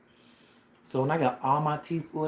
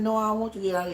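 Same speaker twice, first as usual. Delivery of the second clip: higher, louder protest; alarmed protest; 9 semitones higher, 5 dB louder, much faster than usual. Retold or imitated. Patient protesting to dentist pulling the tooth, higher and louder. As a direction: stop her before she pulls it.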